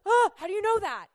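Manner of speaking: high, loud mock alarm; startled suspicion. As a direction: gasp as if found out. Mock alarmed reaction to a word.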